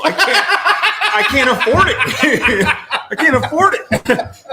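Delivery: can barely get it out for laughing. Retold or imitated. Retelling the brother's joke about gas prices, laughing. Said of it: "And I was dying laughing".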